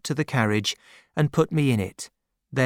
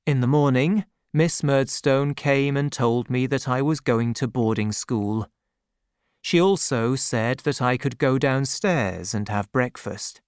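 ordinary delivery, nothing marked

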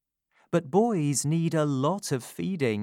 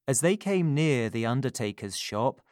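ordinary delivery, nothing marked

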